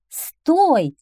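high, loud commanding cry; fox's shout. Acted. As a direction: call out sharply to halt someone. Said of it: "крикнула лисица". (громкий высокий окрик лисы)

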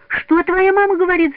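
put-on very high, loud children's question; eagerly curious. A narrator's reading. The children press Петя to tell, eagerly curious. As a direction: pipe up keenly, pitch soaring. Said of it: "заинтересовались ребята".

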